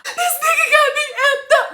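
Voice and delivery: loud high mock wail; hammed-up tearful outburst on the phone; crying, carrying on. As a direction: imitate yourself bawling to your best friend. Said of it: "I'm crying and carrying on".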